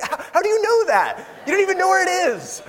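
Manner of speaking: high, laughing incredulous retort; mock-amazed, laughing, voice jumping up; laughing disbelief. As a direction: scoff at them through a laugh.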